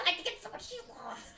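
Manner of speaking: hushed urgent yell; whisper-shout over the crying. Acted. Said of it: "I whisper shout over her".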